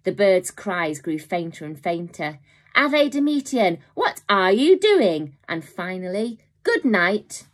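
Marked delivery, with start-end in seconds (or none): 2.8-5.4 high, slow squawking bird; talking bird squawk. A narrator's reading. Bird cries fading away. 6.6-7.5 talking bird. A narrator's reading. Bird's cry.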